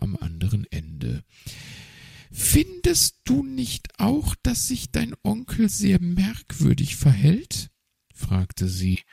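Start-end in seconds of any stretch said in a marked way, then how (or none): 2.3-7.7 high, nervous magpie voice; chatty bird lady, higher. A narrator's reading. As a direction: play the magpie up high and hesitant. Magpie asks young fox.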